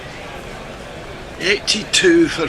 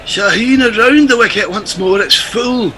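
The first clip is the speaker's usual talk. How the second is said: commentary in a put-on Scottish accent; mock Scottish cricket commentator; a Scottish lilt on the play-by-play. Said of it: "you are going away from Billy Connolly".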